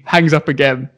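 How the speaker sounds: normal talking voice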